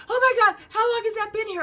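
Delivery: high, loud mock panic; imitated freak-out. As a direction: shriek the question like a panicking friend. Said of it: "freaking the fuck out".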